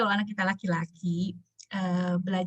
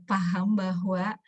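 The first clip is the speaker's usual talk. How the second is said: usual speaking voice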